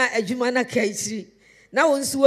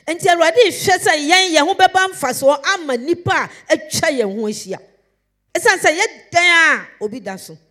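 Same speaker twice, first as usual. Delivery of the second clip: raised, loud preacher's cry; fervent loud preaching; 5 semitones higher, 8 dB louder than usual. Acted. Preacher urging believers to serve others, loud and fervent. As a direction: lift the congregation with fervour.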